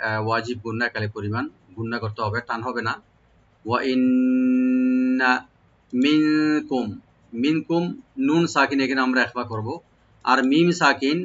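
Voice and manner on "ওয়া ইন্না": ইন্না with a held nasal নুন; nasal ghunna. Shown as a demonstration. Teacher demonstrates ghunna on ইন্না to students. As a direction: hold the doubled n of ইন্না with ghunna, slowly. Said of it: "নুন মুশাদ্দাদ এখানে ওয়াজিব গুন্না".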